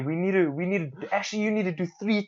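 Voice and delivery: bossy brother. Retold or imitated. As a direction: nag like a pushy manager. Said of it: "He's like".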